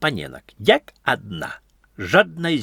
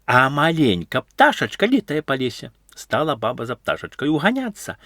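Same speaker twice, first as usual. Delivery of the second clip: plain reading voice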